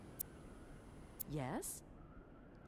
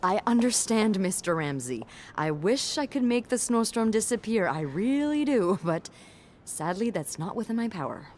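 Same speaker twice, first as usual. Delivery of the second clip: loud, emphatic customer-service plea; firm placating; 12 dB louder than usual. Acted. Receptionist placating a guest about the snowstorm, firmly. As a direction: project patience at a difficult guest.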